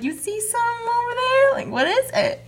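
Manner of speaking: high coaxing pet voice; high baby-talk to dog. Pretend. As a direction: ask the dog sweetly, up high. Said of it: "and I'm like".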